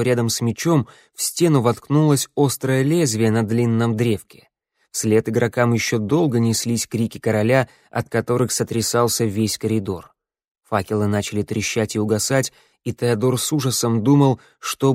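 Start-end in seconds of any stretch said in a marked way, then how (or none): none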